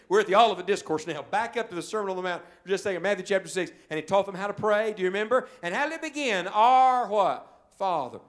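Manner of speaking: high, loud preaching; full-force preaching. Acted. Preacher pressing the congregation on forgiveness, loud and high. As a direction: drive the sermon home.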